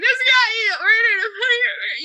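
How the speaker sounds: high, loud, tearful wail; mock sobbing. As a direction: blurt it out while bawling. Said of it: "I just start sobbing".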